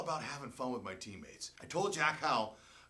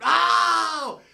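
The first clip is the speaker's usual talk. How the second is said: much higher, very loud shriek; teammates screaming; 16 dB louder than usual. Retold or imitated. Teammates shrieking at the worm eating, loud. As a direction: scream in disbelief. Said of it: "They screamed".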